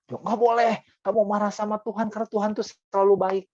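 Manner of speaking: imitating a pious person telling someone off; preachy scolding. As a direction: rattle it off like a self-righteous believer.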